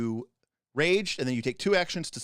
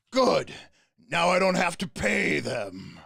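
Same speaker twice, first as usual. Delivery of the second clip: mercenary boss voice; 3 semitones higher, 4 dB louder, slower than usual. Acted. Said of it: "he goes".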